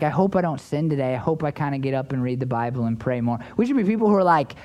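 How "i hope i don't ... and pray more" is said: low, understated mock-meek wish; meek hoping. Preacher imitating Christians who only hope, meek and low. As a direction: mimic a hesitant believer, pitch sinking low.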